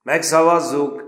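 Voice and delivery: rowdy cry of the whole class; shouted vote. A narrator's reading. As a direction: shout it down the table. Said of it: "közbe kiáltott".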